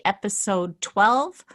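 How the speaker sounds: ordinary delivery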